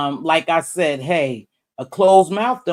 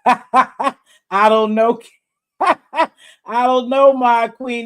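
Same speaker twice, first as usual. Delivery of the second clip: laughing; 7 semitones higher, 5 dB louder, much slower than usual. Real, not acted. Laughing off a viewer's question.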